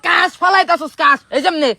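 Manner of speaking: loud, high, insistent teaching; worked-up teacher explaining forcefully; emphatic instruction. Shown as a demonstration. Teacher loudly explains throat sound.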